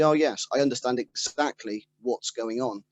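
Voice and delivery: normal talking voice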